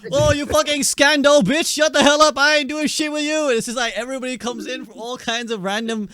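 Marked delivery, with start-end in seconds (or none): 0.1-3.5 hammed-up raging, louder and higher; mock angry player. EU player mocked flaming a teammate, mock rage. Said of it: "It's like".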